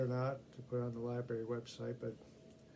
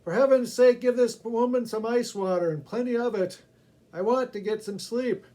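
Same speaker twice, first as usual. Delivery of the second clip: loud, high, fed-up demand; exasperated; 9 semitones higher, 11 dB louder than usual. Acted. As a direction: make him sick of the noise. Annoyed passenger demands ice water.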